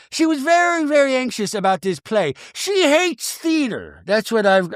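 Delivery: high-pitched, mock-defensive explanation; Meredith in confessional. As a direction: lightly play a reality star justifying herself. Plays Meredith in talking head.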